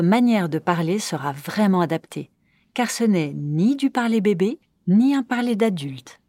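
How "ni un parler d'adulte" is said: ordinary talk, nothing marked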